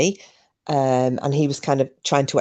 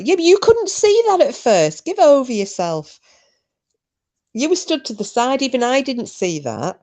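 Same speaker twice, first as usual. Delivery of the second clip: high-pitched, protesting comeback; indignant retort; 9 semitones higher than usual. Real, not acted. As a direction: squeal back in defence, pitch shooting right up. Retorting to Porky's off-mic remark.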